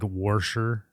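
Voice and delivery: "warsher" with an R before the "sh"; added R. Retold or imitated. Imitating wife's regional word, "warsher" with added R. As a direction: say "warsher" with an intrusive R. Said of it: "they say, like".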